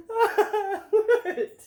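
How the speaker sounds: high laughing yelp; laughing what. Real, not acted. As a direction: laugh it out loud. Laughing at dog.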